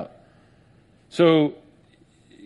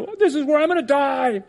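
despairing unbeliever; 10 semitones higher than usual. Retold or imitated.

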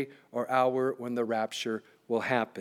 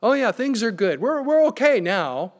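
mock relief, mostly higher and louder; the world, relieved; 4 semitones higher, 4 dB louder than usual. Preacher mimicking the world celebrating peace, mock relieved. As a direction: voice a complacent world with a swooping "Oh". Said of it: "the world is going to say".

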